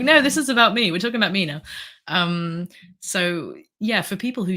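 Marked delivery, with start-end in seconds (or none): 0.0-1.6 indignant protest. Retold or imitated. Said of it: "I'm like".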